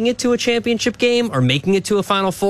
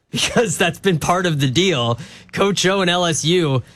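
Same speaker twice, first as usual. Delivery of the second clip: usual speaking voice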